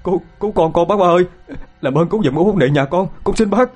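high, whimpering plea for help; tearful begging. Acted. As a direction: beg desperately, close to tears. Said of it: "Anh Tám miếu máu".

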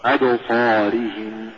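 slow, measured أبصارهم; correct recitation. Shown as a demonstration. Doctor answers what is correct, measured أبصارهم. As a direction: draw out أبصارهم as a careful model. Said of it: "إذا ما الصواب؟".

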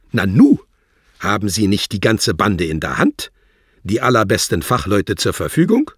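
loud, pressing menace; threatening. Acted. Wladimir threatens Worlock with finding the anarchists himself, loud and menacing. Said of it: "fuhr Herr Wladimir drohend fort".

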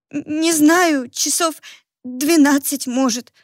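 high, hesitant, faltering; cautious stammer. Acted. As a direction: stammer out a careful guess. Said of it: "осторожно проговорила она".

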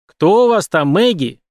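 detective shouting to Maggie through the door. A narrator's reading. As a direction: call through a locked door. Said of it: "продолжал кричать Бэнкс".

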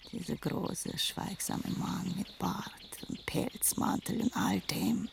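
soft, breath-light musing; wistful recollection. Acted. Woman recalling her old lover, softly wistful. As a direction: let the voice sink into a quiet memory.

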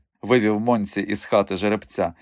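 plain reading voice

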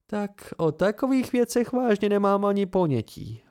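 a touch of mock sarcasm; with a smirk. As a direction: say it with a crooked grin. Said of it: "ušklíbla se".

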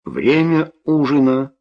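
slow called announcement; announcing. A narrator's reading. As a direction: call it out slowly, like a bell. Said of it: "объявила".

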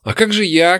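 high-pitched mild shout; crying out. A narrator's reading. Elf objecting to the orc, high cry. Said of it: "крикнул эльф".

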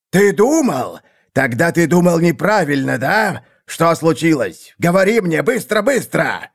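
high, impatient interrogation; impatient anger. A narrator's reading. Seer grills his failed assassin, impatient. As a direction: pounce on the excuse and demand the rest. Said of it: "хлестал по полу от нетерпения".

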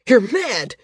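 a cornered man shouting back; shouted. A narrator's reading. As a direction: yell it out as he cowers. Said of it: "he shouted".

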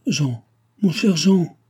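slow, high, loud appeal; crying out. A narrator's reading. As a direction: call pleadingly, stretched out. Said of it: "cria Chon".

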